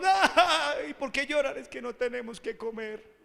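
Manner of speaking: hammed-up tearful complaint; mock crying. Preacher mimics a family claiming hunger, mock crying. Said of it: "encuentra a su esposa llorando".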